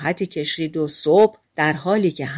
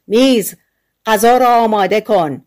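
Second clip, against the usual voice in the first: clear, ringing order, raised and louder; resounding command; 4 semitones higher, 5 dB louder than usual. A narrator's reading. Young carpenter orders the table to lay food, resounding voice. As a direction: project the magic command so it carries. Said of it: "با صدایی رسا گفت".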